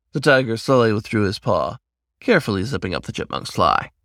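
ordinary delivery

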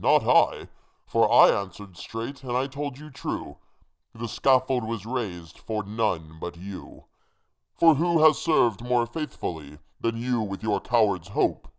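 loud, forceful hangman's voice; accusing hangman. A narrator's reading. Hangman rebuking the one who served him, loud accusing declamation. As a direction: declaim it as the hangman, hitting words hard.

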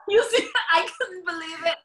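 high and loud, laughing through the words. Real, not acted. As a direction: talk while still cracking up. Laughing at the price.

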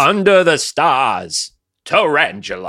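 slow, high, loud hammed-up old movie star; James Stewart impression. Trading movie-star impressions, slow high hammy Stewart voice. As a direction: stretch it out like a classic Hollywood leading man. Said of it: "James Stewart doing forty Dogs".

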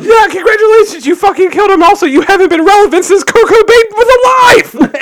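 high, loud sarcastic yell; mock shout. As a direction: yell it like a jeering fan. Mocking Courtney, shouting.

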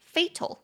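fatal stressed on the first syllable, FAY-tal; first syllable of fatal leads, second falls away. Shown as a demonstration. Teacher stresses the first syllable of fatal. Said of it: "中音节在第一音节".

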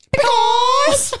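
put-on a high, loud, drawn-out kid's shout; little boy's squeal. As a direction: wail it like a giggling little boy. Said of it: "He goes".

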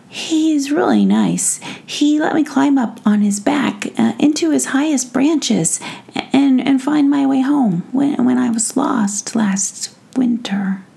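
put-on sweet kid telling a story; child voice. Child Sander speaks.

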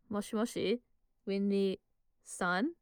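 "Moshi moshi", pause, then "Winry-san" drawn out slowly and rising; hesitant questioning. Retold or imitated. Holding the phone away, questioning "Winry-san?" slowly with long gaps. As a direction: leave dot-dot-dot gaps before and inside "Winry-san?", ending in a question. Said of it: "questioning it".

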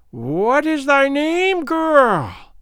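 high, drawn-out piping crone; piped, long-stretched demand. A narrator's reading. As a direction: pipe it up high and slow. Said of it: "piped Gagool".

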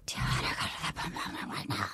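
mock sultry whisper; hushed teasing invitation. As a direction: breathe it like a secret come-on. Retold seductive proposition.

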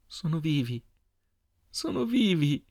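high, tearful joy, rising on the repeat; overwhelmed with relief, building in pitch; crying and laughing together. A narrator's reading. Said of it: "Stava piangendo e ridendo insieme".

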